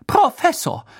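very high, loud outrage; scandalised exclamation; indignant. A narrator's reading. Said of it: "sagte Madame Pomfrey empört".

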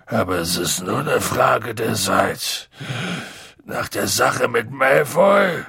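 thick, tipsy big-man voice; gloomy drunken grumble; drunk, dejected Hagrid. Acted. Drunk Hagrid frets over job.

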